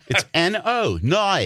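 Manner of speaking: high Australian spelling-out; Australian accent. Acted. Australian explaining the word, exaggerated Australian accent. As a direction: spell it, then twist "no" in Australian. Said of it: "Australian people".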